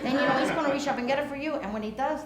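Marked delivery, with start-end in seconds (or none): none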